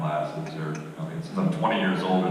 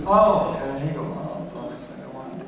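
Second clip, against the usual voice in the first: mock downplayed brag; 7 semitones higher than usual. Comedian imitating his golf partner after an eagle, mock nonchalant. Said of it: "Like that".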